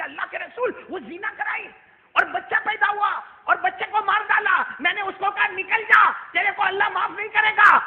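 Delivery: indignant report to the Prophet; angry telling. Acted. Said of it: "ابو ہریرہ غصے میں کہنے لگے".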